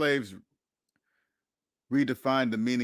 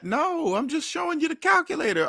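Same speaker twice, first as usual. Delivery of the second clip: much higher, playing innocent; innocent mock reply; 13 semitones higher than usual. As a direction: say it like you're only pointing at the numbers. Innocent reply to offended friend.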